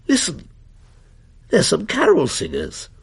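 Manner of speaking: put-on a boy's voice cracking up high then dropping; teenage voice breaking, jumping high then plunging. A narrator's reading. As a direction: let the voice crack and croak mid-line. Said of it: "which was breaking, plunged and croaked".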